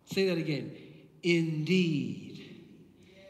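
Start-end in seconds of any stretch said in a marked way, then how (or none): 1.2-2.4 "indeed" low and drawn out; emphatic INDEED. Acted. Preacher quotes the Son's promise, weighty emphatic "indeed". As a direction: stretch "indeed" slowly as a weighty yes. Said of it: "It's an emphatic yes".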